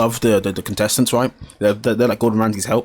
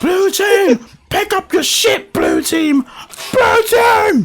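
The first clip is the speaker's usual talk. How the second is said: very high mock yelling; hammed-up kitchen shouting; angry chef impression; much slower than usual. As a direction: holler at the team like an irate chef. Imitating angry chef yelling at team.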